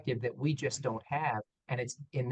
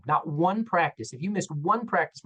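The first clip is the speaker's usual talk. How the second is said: insisting that a single missed practice counts, stressing one; 4 semitones higher than usual. Real, not acted. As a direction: punch the word one both times. Hammering home the criterion.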